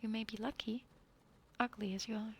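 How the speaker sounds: ordinary delivery, nothing marked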